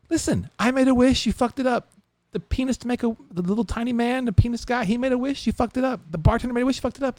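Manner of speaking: high-pitched acted rant; mock-outraged high rant; angry complaint. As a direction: pile up grievances in a high, exasperated voice. Patron rants at the genie.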